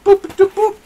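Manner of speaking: high, loud boops; squeaky little figure noises; imitated game beeps. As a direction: pipe out tiny high boops.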